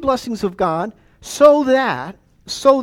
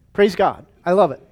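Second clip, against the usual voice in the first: ordinary talk, nothing marked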